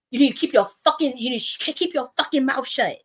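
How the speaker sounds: high, exasperated order, hitting each swear; imitated pissed-off scolding. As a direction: lay down the law as the fed-up man. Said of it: "That's how he said it".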